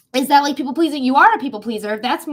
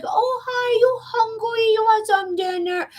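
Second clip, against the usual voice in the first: high cooing pet voice, much higher; talking to pets; 10 semitones higher, slower than usual. Retold or imitated. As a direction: coo at the cat like it's a baby. Greeting hungry pets.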